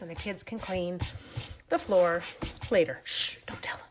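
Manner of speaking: playful secretive whisper; conspiratorial whisper. Pretend. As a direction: hush them and whisper it like a secret. Conspiratorial secret about the messy floor.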